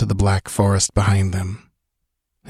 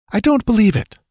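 high, doubting woman's voice; incredulous young woman; Valeria, incredulous; 14 semitones higher than usual. A narrator's reading. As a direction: read her disbelief in a raised voice. Valeria doubting Conan's claim.

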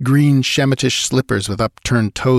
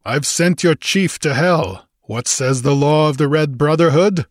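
Conan's defiant voice; 6 semitones higher than usual. A narrator's reading.

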